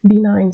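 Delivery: "Benign." said as be-NINE, with the g silent; model pronunciation. Shown as a demonstration. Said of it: "So the word benign is one of them".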